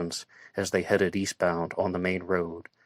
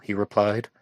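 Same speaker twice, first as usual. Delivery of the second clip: usual speaking voice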